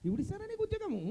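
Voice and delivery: high-pitched incredulous question; high exasperated refusal. Acted. Host repeats the refusal, exasperated.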